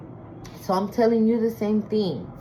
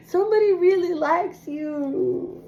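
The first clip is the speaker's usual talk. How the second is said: emotional; 8 semitones higher than usual. Real, not acted. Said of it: "I feel so emotional".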